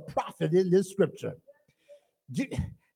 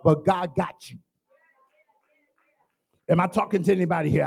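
ordinary talk

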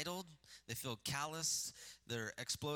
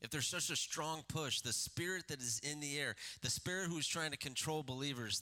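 ordinary talk

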